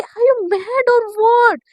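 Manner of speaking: high, loud, slow drawn-out yell; loud mock-outraged question; incredulous yell. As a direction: stretch every word in disbelief. Shaili yells at Misha.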